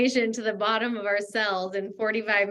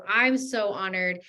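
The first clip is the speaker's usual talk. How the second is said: ordinary talk, nothing marked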